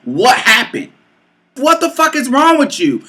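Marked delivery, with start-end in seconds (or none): 1.5-3.0 high-pitched, exasperated challenge; indignant demand. Retold or imitated. Woman confronting her lover over his outburst, indignant. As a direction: confront him, voice jumping up. Retold quarrel with the man.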